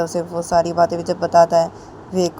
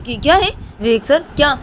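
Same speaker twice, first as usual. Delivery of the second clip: higher, halting questions; indignant protest; 6 semitones higher, 4 dB louder than usual. A narrator's reading. Student protests being called only a student, indignant. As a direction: object in disbelief, pushing harder at the end.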